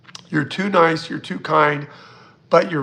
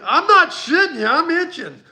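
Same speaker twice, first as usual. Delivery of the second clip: excited; 12 semitones higher than usual. Real, not acted. Telling viewers the tree bugs are on her, excited. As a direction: squeal it, giddy and itchy.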